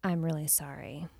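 low, subdued, unemotional apology; stone-faced. Retold or imitated. Woman repeats it over and over as she walks him out, stone-faced. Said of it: "with the stone face, like you said".

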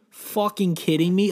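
normal talking voice

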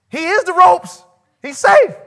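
shouted high declaration; loud preaching. Real, not acted. Preacher booming to the congregation. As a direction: proclaim it at full force.

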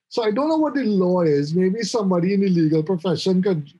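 ordinary delivery

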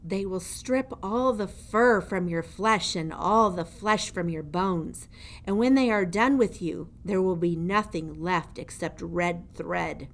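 put-on menacing old jailer. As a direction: lean over the prisoner and gloat. Gregory gloats.

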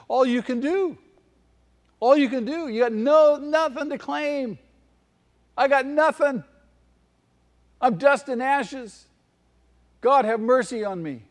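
high, strained cry of a sinner with nothing to claim; desperate penitent. Acted. Preacher voicing a penitent to his congregation, anguished plea. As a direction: plead as a broken man before God.

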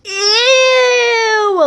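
put-on a kid's grossed-out squeal; disgusted. Little sister reacts to a fart.

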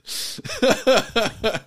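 high, real burst of laughter; lingering laughing fit. Real, not acted. Cracks up at the joke.

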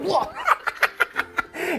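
high squeal of surprise; a gnome's shocked squeal. Acted. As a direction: yelp as the punch lands. Gnome punch scene.

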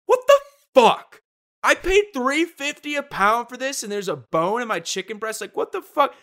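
high, loud mock outrage; squeaky indignant complaint; outraged customer. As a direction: rant like a cheated shopper. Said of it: "and you're like".

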